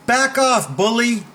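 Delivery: high, firm call-out; forceful warning. Shown as a demonstration. Coach repeats line against a bully, high and firm. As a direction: warn them off in a high voice.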